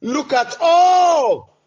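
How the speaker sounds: slow, loud, high outcry; anguished cry. Real, not acted. Sufferer showing God their afflictions, in a slow loud outcry. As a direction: cry out the pain to heaven.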